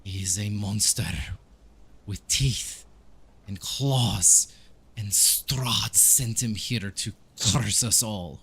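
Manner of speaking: put-on slow, weighty accented pronouncement; anguished priest. Priest insisting his son is a monster, grimly emphatic. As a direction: spell it out phrase by phrase with dread.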